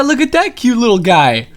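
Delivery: ordinary talk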